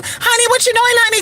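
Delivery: high-pitched mimicking pet voice; talking to a bird. Shown as a demonstration. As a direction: squeak it like you're mocking a parrot. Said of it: "they hate when you".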